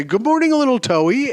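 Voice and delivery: high, chirpy good-morning call; bright, happy morning greeting. Shown as a demonstration. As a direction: greet the day bright and bushy-tailed.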